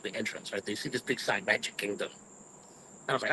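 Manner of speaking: ordinary delivery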